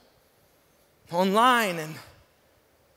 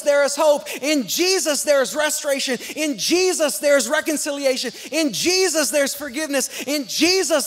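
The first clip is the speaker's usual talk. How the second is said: higher, rhythmic repeated litany; rousing sermon cadence; preaching refrain; 4 semitones higher than usual. Acted. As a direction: build the In Jesus chant.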